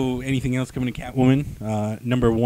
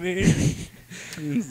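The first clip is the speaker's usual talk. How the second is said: normal talking voice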